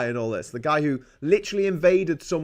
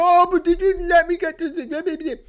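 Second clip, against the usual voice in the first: high, whiny mocking voice; whiny motorist; 12 semitones higher, 6 dB louder than usual. Mocking drivers angry at Just Stop Oil, high and whiny. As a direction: squeak it out like a petty complainer. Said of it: "They go".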